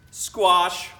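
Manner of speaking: loud playful punchline. Real, not acted. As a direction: land the joke with gusto.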